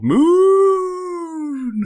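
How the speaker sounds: high, slow, badly done wolf howl. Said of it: "That's a really crap impression of, a, of a wolf".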